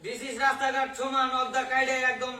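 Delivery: hammed-up speech in broken English; mock public speech. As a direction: declaim it loudly to the audience.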